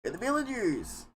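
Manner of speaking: lightly voiced trailer imitation; mock awe. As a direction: say it like the movie trailer. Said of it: "and you're like".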